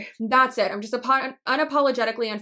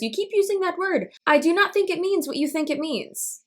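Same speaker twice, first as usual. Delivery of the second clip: higher-pitched movie-quote voice, lightly acted; Inigo Montoya; 6 semitones higher than usual. Vlogger quoting a film character at misused words, playful impression. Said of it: "Inigo Montoya says to what's-his-face".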